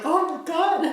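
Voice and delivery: mock anguish over a character's fate; shocked oh my God. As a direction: let the shock jump up in pitch. Retold shock at a story twist.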